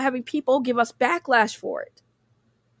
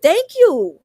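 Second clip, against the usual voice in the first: emphatic; 6 semitones higher, 8 dB louder than usual. Real, not acted. Emphatic agreement with chat.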